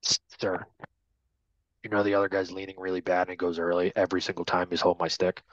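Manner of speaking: put-on a kid sneaking a complaint, fast and low; player quietly complaining. Player tattles privately to the official, hushed and hurried. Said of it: "they won't tell you in front of the other guy".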